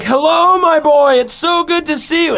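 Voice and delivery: high and loud, overflowing with joy; loud joyful greeting. Retold or imitated. Father greeting his newborn son, loud joyful high voice. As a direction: greet him as loud as your happiness. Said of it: "And it's so loud".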